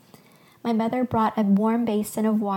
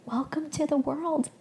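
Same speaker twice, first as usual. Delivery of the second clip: high, gentle welcome to a newborn; tender, holding back tears; 6 semitones higher, 4 dB quieter than usual. A narrator's reading. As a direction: welcome the baby softly, voice lifted with emotion. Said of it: "willing myself to stop crying".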